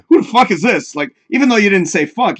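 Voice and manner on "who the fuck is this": mock-aggressive demand, a bit higher; hostile reading. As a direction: imitate reading it as an insult. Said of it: "I read it like".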